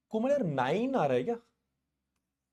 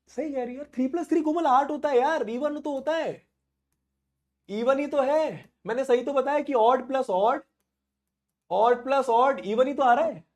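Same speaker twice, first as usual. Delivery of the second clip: mock insistence; 6 semitones higher than usual. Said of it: "मैं मजाक कर रहा हूँ".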